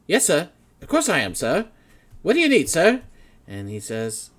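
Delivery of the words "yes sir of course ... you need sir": high, loud, overeager yes-sir voice; eager officer. Acted. Officer startled by her boss, answers high and eager. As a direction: snap to attention like a startled junior officer. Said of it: "And she says".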